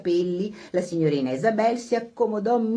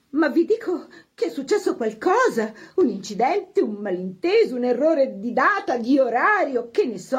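higher voice, grasping at explanations; insist, with rising agitation; agitated hostess; 7 semitones higher, 4 dB louder than usual. A narrator's reading. Hostess insists something has gone wrong, agitated.

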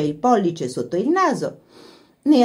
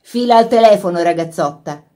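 brisk order; 2 semitones higher, 6 dB louder than usual. A narrator's reading. Lori sending his sister to the telephone, brisk. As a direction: send your sister off briskly. Brother sends Laura off to phone.

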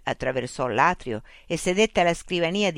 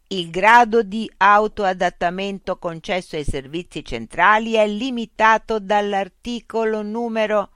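machine voice; 6 semitones higher, 7 dB louder than usual. Acted. Loudspeaker robot voice addressing Mitch. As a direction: speak as a polite, mechanical information unit.

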